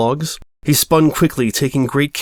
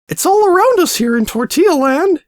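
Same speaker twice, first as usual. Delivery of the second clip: much higher, silly squeaky voice; goofy high-pitched gnome; 4 dB louder than usual. Acted. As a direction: squeak it like a goofy little smurf. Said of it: "goofy, high-pitched voice".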